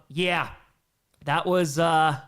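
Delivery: ordinary talk, nothing marked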